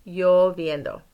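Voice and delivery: "Lloviendo" alone, clearly beginning with a Y sound; Y sound isolated. Shown as a demonstration. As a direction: say "Lloviendo" slowly, "yo-vee-EN-do". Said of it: "I shouldn't hear any L sounds in there".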